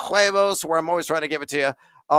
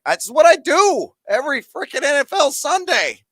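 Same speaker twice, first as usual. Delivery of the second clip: fired-up exclamation; 11 semitones higher, 4 dB louder than usual. Real, not acted. Host fired up thanking a viewer.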